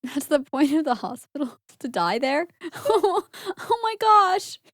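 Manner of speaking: incredulous, laughing exclamation; scandalised giggling, ending high and drawn out; shocked laughing. Real, not acted. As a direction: laugh through the disbelief, pitch climbing. Laughing in shock at dark remark.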